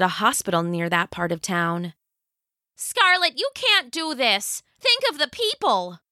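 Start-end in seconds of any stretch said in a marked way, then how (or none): 2.8-6.0 much higher, pleading cry; desperate mother. Acted. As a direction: beg her to stop.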